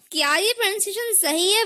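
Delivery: normal talking voice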